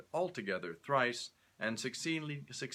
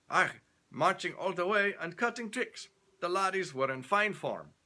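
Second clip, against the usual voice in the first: put-on loud, high, hearty Scottish accent; Scottish soldier; 9 semitones higher, 4 dB louder than usual. Stewart boasting to Leonardo, hearty Scottish accent. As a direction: answer like a proud Scot.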